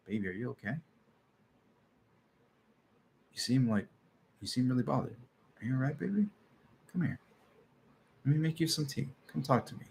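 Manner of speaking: tender soothing. Retold or imitated. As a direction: coax an upset partner softly. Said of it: "and you're like".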